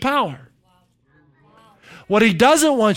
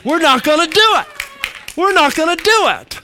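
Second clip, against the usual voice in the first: higher, louder preaching cry; rallying shout; 8 semitones higher, 3 dB louder than usual. Real, not acted. Preacher rallying the church against depression, defiant shout. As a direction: lift the room, defiant.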